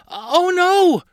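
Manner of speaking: high, loud cry of dismay; alarmed exclamation. A narrator's reading. Carly forgot to turn the boat, exclaiming in alarm. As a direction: cry out as disaster looms. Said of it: "Carly exclaimed".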